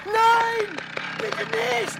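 film victim begs the killer; terrified pleading. Acted. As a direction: beg for your life in panic.

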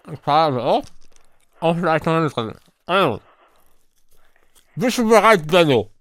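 talking round a mouthful of marshmallows; mouth full. Real, not acted. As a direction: cheeks stuffed, words mushy. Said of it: "ich lese dir mit vollem Mund".

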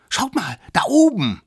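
high, loud diversion; high-pitched excited pointing-out; desperate little one. Acted. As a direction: call out urgently in a squeaky voice. Smallest points at sky in desperation.

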